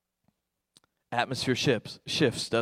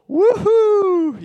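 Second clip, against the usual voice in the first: put-on high, excited little-kid woohoo; squealed kid's woohoo; child's woohoo; 12 dB louder than usual. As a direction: cheer like a thrilled small boy. Son's excited cheer imitated.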